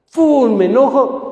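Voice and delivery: mock burst of anger, high and loud; a quick pretend outburst; sudden anger. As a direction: flare up abruptly, pitch jumping up. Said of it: "La emoción es algo intenso y pasajero".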